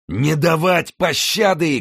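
very high, slow battle shout; fierce shout. A narrator's reading. Enemy rider answers Winter, high fierce drawn-out shout. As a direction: stretch the merciless order into a cry. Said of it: "крикнул по-французски".

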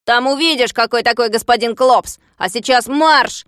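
loud, bossy retort; gruff captor. A narrator's reading. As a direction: cut him off and order him to march. Captor orders his prisoner off.

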